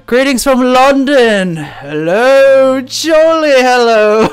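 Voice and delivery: loud, high, drawn-out British greeting; mock British accent. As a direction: ham up a jolly British hello. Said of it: "all my British viewers".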